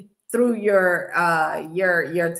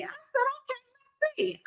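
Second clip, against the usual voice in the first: mock disbelief; 13 semitones higher, 3 dB quieter than usual.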